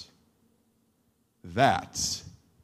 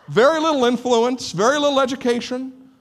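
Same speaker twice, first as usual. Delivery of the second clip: laughing; 7 semitones higher, 7 dB louder than usual. Real, not acted. Laughing aside about the crowd.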